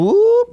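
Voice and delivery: sudden high yelped whoop; belly whoop. Pretend. Joking about his growing belly, sudden high yelp. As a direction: squeak it out like a belly popping.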